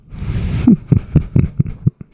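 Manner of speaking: high mock villain laugh; evil cackle. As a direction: cackle like a cartoon baddie.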